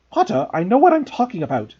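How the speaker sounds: short and sharp, cutting him off; teacher shutting a pupil down curtly. A narrator's reading. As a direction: clip the reply to end it. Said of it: "she said shortly".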